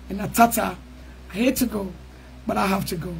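low, soft, reluctant sign-off; soft farewell. Real, not acted. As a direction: say goodbye gently, dropping low and quiet.

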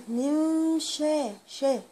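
slow nasal hiding demo; ikhfa on sha. Shown as a demonstration. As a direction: stretch من شا very slowly. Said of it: "هنا في إخفاء".

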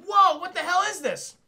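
loud, high mock astonishment; acted shock. Peter seeing the news on a little TV, acted shock. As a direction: burst out in hammed-up disbelief. Peter sees villains on the television.

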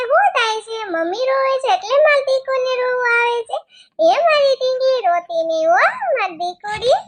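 put-on aunt soothing her niece in sing-song baby talk; cooing to a baby. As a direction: coo over the crying little one.

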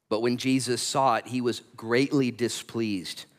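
slow, measured Bible reading; slow dramatic reading of the Gospel; scripture reading. Acted. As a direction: read the passage out to the congregation, unhurried. Reads the scripture passage aloud.

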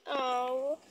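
normal talking voice

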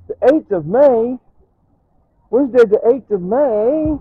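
high, loud and slow incredulous question; surprised disbelief. Real, not acted. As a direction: stretch it out, amazed at the date. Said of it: "What did I say it was?".

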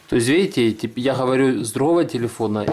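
ordinary talk

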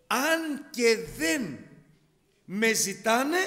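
drawn-out, loud, weighty reveal; God spelling out a great secret, slow and loud; slow emphatic. Acted. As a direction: lean on each word slowly and loudly.

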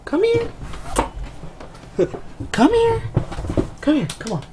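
very high, sing-song coaxing call; calling the dog. Real, not acted. Calling his dog Nico over in a very high coaxing voice. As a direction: coax the dog over in a squeaky voice.

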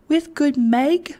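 put-on high, slow little child voice; child's cry. A narrator's reading. Child caressing Meg, high childish asking. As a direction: ask it high and wide-eyed, like a child. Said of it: "cried the child".

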